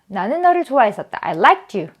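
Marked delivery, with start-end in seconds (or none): 1.2-1.9 "liked you" joined as likt-you; linked t ending. Shown as a demonstration. Teacher repeating the model sentence, "liked you" linked with t. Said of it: "요렇게 발음이 됩니다".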